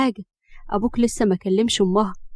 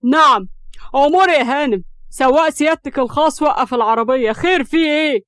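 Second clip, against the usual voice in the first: loud, raised, heated sarcasm; sharp, worked up; 4 semitones higher, 5 dB louder than usual. A narrator's reading. Siraj, playing her private driver, retorts sharply. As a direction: lash back with sharp indignation. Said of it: "بيتكلم بحدة وانفعال".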